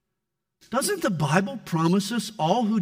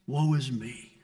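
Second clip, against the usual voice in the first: self-pity; 6 semitones lower, 5 dB quieter than usual. Pretend. Mocking pastors' self-pity.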